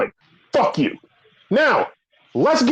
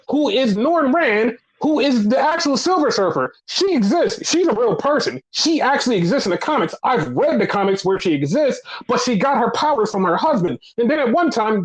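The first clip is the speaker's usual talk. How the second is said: ordinary talk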